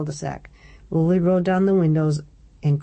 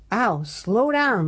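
cried out; 6 semitones higher, slower than usual. Acted. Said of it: "Lily cried".

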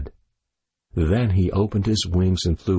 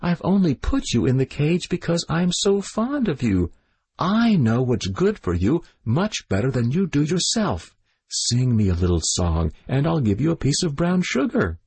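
lightly raised, doting princess voice; fond princess September; 6 semitones higher than usual. A narrator's reading. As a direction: coax the bird kindly, a bit higher. September talks to her bird.

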